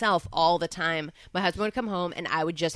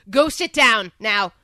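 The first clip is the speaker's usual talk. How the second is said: slow, loud brush-off; dismissive command; 2 semitones higher, 8 dB louder, slower than usual. Retold or imitated. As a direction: order him to sit down. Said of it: "We go to".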